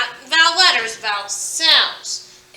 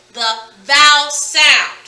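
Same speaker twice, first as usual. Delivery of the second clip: VOWEL SOUND punched loud and slow; slow, loud stress on vowel sound; emphatic key term; 7 dB louder, slower than usual. Shown as a demonstration. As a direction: hit vowel and sound separately.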